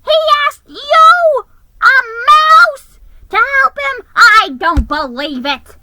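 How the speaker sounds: high, loud indignant yell; shrill jealous elf ranting; outraged disbelief. Acted. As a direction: shout it, unable to believe it.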